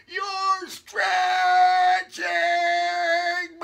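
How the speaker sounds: high, stretched-out wailing shout; slow, loud, high wail; yelled. A narrator's reading. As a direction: stretch the words as if being pulled. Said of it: "yelled Haley".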